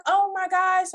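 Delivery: high, loud "oh my gosh"; alarmed exclamation. Retold or imitated. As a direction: burst out in alarm to mom. Telling mom about prophecy.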